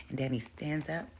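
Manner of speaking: ordinary talk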